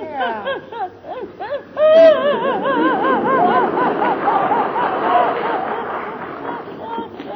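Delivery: long falling opera laugh; hearty laugh. Acted. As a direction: laugh out fully, from the chest. Mother laughs aloud. (开怀大笑)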